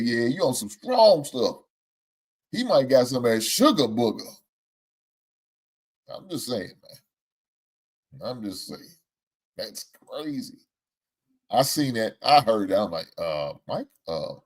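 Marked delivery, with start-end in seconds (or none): 6.1-10.6 much softer under-the-breath remark; soft muttered I'm just saying; muttered aside. Real, not acted. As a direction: say it to yourself, quietly.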